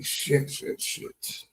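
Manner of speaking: hushed frustrated whisper; whispered cursing. Real, not acted. Worker dreading his early shift whispers curses. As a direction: breathe the curses under your breath. Stressed about work morning.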